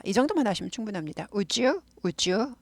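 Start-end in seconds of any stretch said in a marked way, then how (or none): 1.3-2.5 "Would you" as "u-jyu", rising; smooth J link in "Would you", question tune; soft linked pronunciation. Shown as a demonstration. Teacher repeating for learners, "Would you" linked with J. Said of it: "발음이 더 편하고 부드러워서".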